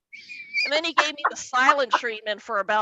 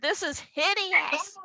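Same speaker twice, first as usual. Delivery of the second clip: higher, prim disgust; scandalized dad; 7 semitones higher, 3 dB quieter than usual. Retold or imitated. Dad scandalized at the concert, high prim disgust. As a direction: clutch your pearls.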